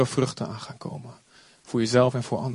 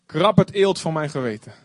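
prayer; 4 dB louder than usual. Acted. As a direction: lead the congregation in prayer. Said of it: "Laten we allemaal maar zeggen".

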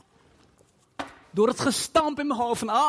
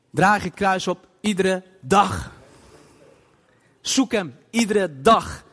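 fervent preaching; 4 semitones lower, 5 dB louder than usual. Acted. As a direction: hammer each phrase from the pulpit. Preaching at full force. (krachtig preken, langzaam en luid)

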